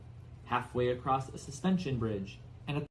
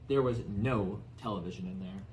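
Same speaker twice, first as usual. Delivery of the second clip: ordinary delivery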